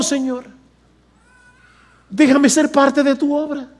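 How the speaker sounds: high-pitched, earnest begging; imploring plea, broken up by pauses; pleading. Shown as a demonstration. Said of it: "el tono tiene que haber sido".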